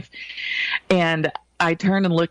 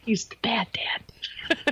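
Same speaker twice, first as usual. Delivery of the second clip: whispered joke, no voice at all; 5 semitones higher, 7 dB quieter, much slower than usual. Real, not acted. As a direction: breathe it out as a secret aside. Joking aside to co-host.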